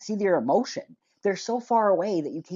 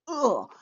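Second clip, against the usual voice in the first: exasperated "ugh", pitched up; disgusted groan; 6 semitones higher, 3 dB louder than usual. Real, not acted. Reviewer fed up with the film's bad dubbing, disgusted groan. Disgusted at badly dubbed dialogue.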